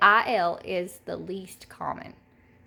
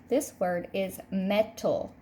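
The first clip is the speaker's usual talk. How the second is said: normal talking voice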